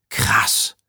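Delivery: awed, voiceless exclamation; fascinated. A narrator's reading. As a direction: let it out in hushed fascination. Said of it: "Fasziniert betrachtete er". (fasziniert gehaucht)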